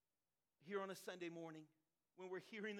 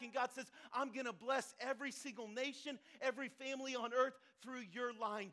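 louder, driving sermon delivery; impassioned preaching; 3 semitones higher, 8 dB louder than usual. Acted. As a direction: preach it with building force. Preaches at full force.

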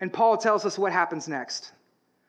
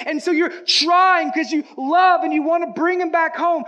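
higher, louder impassioned preaching; preaching at full force; 8 semitones higher, 4 dB louder than usual. Acted. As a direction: press the point home from the pulpit. Preaching builds in intensity.

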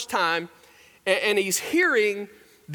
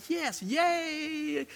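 high, soft mock squeal; hushed inner cheer; 8 semitones higher, 8 dB quieter than usual. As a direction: cheer in a small, stretched voice. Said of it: "that's just like".

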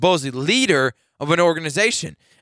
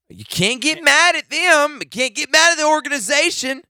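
high, insistent rebuke; indignant scolding; 11 semitones higher than usual. Real, not acted. Host scolding a player who blames the team, high-pitched and indignant. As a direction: drive it home with pitch way up.

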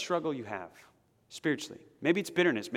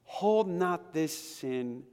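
higher, pleading prayer, slowing to a hushed close; earnest prayer; 6 semitones higher than usual. Pretend. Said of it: "I can also pray".

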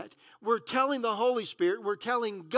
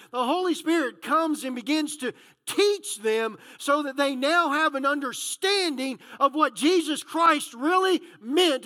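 higher, driving preacher's voice; excited preaching; 4 semitones higher, 4 dB louder than usual. Real, not acted. Preacher telling how the disciples receive the Spirit, excited. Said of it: "I'm getting all excited".

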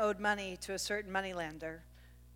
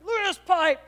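higher, louder boastful yell; boys showing off; 10 semitones higher, 9 dB louder than usual. Retold or imitated. Sons flexing in the kitchen, loud and boastful. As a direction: crow over your muscles. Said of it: "They're like".

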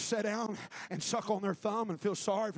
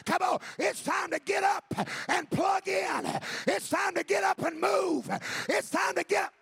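loud, high, driving sermon voice; building revival preaching; preaching at full force; 5 semitones higher, 5 dB louder than usual. Acted. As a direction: rouse the church to get up.

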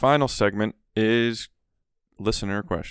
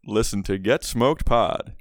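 radio announcer. Pretend. Host closes the podcast with a plug, radio announcer voice. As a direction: sign off like an oldies radio host. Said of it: "It's an announcer voice".

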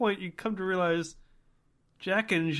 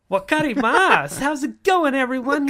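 high, bright put-on cartoon voice; peppy anime voice; 9 semitones higher, 6 dB louder than usual. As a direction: chirp it like an anime host. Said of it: "maintain that in voice".

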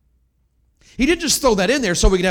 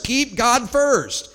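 each word slowed and pushed out louder; slow emphatic command; 5 semitones higher, 3 dB louder, much slower than usual. Acted. Preacher exhorting his church, slow and firm. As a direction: hammer it home slowly.

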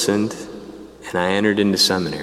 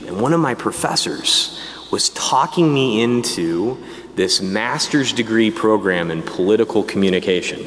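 ordinary delivery

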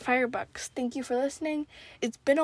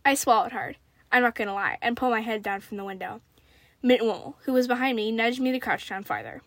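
lively, deliberate read-aloud of a tense tale; story narration; 5 dB louder than usual. A narrator's reading. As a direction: read the story out with animated dialogue.

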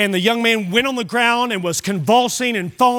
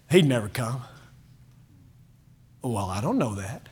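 soft doubting murmur; 12 semitones lower, 9 dB quieter than usual. Retold or imitated. Repeating the doubt softly.

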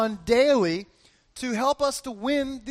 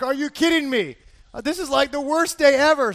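higher, exasperated outburst; 5 semitones higher, 6 dB louder than usual. Retold or imitated. Driver to himself after a fender bender, exasperated. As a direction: build in volume to an incredulous complaint. Reacting to hitting a car.

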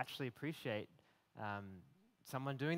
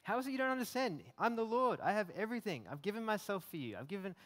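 higher, pressing, rising frustration; exasperated Jesus; 4 semitones higher, 4 dB louder than usual. Retold or imitated. Jesus reminding a worried believer, higher pressing plea. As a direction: insist like a loving teacher out of patience. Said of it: "imagining him speaking to me about that".

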